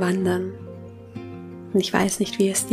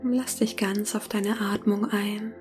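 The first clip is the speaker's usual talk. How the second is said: soft spa whisper; 8 dB quieter than usual. Acted. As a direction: whisper gently, guiding them into calm. Leitet eine Meditation. (sanftes Meditationsflüstern)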